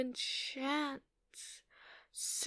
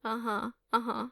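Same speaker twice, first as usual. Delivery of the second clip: usual speaking voice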